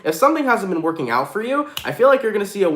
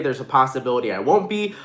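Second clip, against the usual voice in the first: ordinary talk, nothing marked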